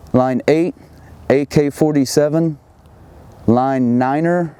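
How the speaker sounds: slow, clipped radio call-out; radio voice procedure. Shown as a demonstration. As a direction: read the report like a radio operator. Sends spot report over radio.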